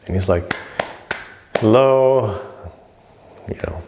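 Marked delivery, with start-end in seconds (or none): 1.6-2.4 higher, drawn-out hello to someone unresponsive; acted hail, trying to get noticed; calling for attention.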